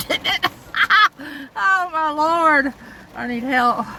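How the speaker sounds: laughing. Real, not acted. Laughing at own silly voice.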